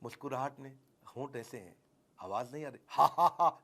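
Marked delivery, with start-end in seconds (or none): 2.9-3.6 louder spoken ha ha ha; demo laugh. Shown as a demonstration. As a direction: show an audible laugh on purpose. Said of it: "नहीं बोल रहा हूँ".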